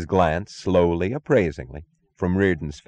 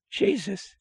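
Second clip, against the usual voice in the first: high, breathless shocked gasp; 5 dB quieter than usual. A narrator's reading. Guard recognizing Francisco, gasping in shock. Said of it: "gasped one of the guards".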